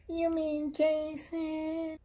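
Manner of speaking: very high, slow, mock-confused squeak; confused suburban kid. Suburban kid asked to play along, high confused voice. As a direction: imitate a sheltered kid, stretched out and baffled. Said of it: "then made a confused, gated community face".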